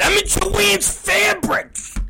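very high, drawn-out strained yell; retold angry yelling. Dad leaving a message from Joann's Fabrics, high exasperated yelling. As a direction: wail it slowly in high exasperation.

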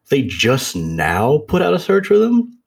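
can't believe they only now searched; mock outrage at the parents; incredulous. Incredulous reaction to news.

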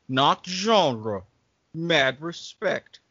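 ordinary talk, nothing marked

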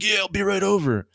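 high-pitched mock bluster; hammed-up eager challenge; retold heated threat. Scammer threatens to come, retold.

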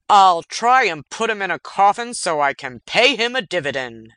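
loud cigar-chomping tycoon; blustering executive. Acted. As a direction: boast it like a jolly undertaker boss. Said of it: "he said, mangling a stoogie".

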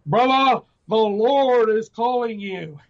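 high, drawn-out proclamation; imitated preacher's call. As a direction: mimic a man calling someone to the Lord, stretching the words. Acting out man's solemn call.

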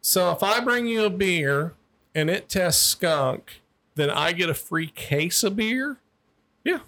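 put-on high, slow, dopey girl; drunk girl voice. Cop imitating the drunk girl asking about free beer, slow and high. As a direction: drag it out like a tipsy coed.

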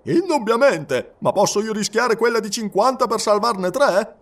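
a captain refusing firmly; stern fort captain. Acted. Captain answers Fogg. (capitano severo, voce alta e decisa)

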